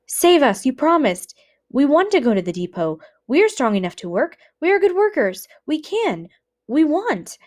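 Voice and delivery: high, loud frantic begging; pleading prisoners crying out to be saved; desperate pleading. Acted. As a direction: beg for your life, voices climbing.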